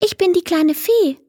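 high, kindly fairy voice; friendly little fairy. A narrator's reading. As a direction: introduce yourself sweetly in a high voice. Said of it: "meinte sie freundlich".

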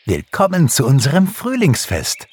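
high, festive welcome; calling out. A narrator's reading. Pixie welcomes the bear to the party, calling out. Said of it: "rief Pixie".

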